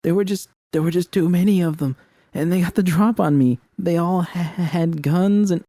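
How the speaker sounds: high, breathless excuses; tripping over words, pitched high; stammering panic. Acted. Narrator stammers excuses to V, high and panicky.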